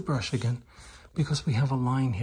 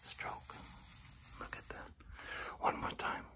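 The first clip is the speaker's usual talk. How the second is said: very soft, hushed whisper; barely audible aside; 14 dB quieter than usual. Real, not acted. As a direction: whisper it while concentrating on the stroke. Whispers during the long stroke.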